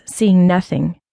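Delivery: ordinary delivery, nothing marked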